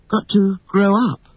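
high, hesitant, regretful admission; wistful girl's voice; reluctant sigh. A narrator's reading. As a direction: confess it reluctantly. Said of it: "sighed Wendy".

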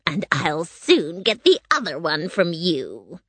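witch voice with wild swoops in pitch; gloating wicked witch. A narrator's reading. Witch promising to steal the other shoe, gloating threat. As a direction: taunt her like a scheming witch. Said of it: "the wicked witch replied".

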